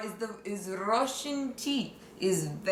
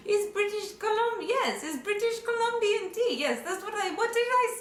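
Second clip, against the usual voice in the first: much higher, flustered yes it's British; flustered cover-up; 11 semitones higher than usual. Acted. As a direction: scramble to cover a slip, voice jumping up. Flustered cover for vodka lie.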